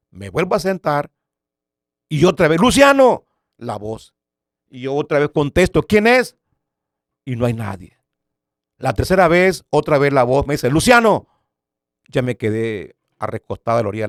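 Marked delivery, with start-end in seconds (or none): 2.6-3.3 loud, high-pitched summons; llamado fuerte. Retold or imitated. La voz lo llama otra vez. 5.8-6.4 high, loud call to an unseen caller; pregunta a gritos. Retold or imitated. Contesta gritando a la voz. 10.7-11.3 high, loud shouted name; llamado fuerte. Retold or imitated. La voz llama por tercera vez.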